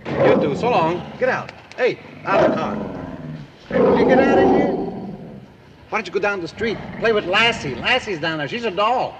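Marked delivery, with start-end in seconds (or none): 1.2-4.7 angry shout. Acted. Steve yells at the dog to leave the car, exasperated. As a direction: drive the mutt out of the car.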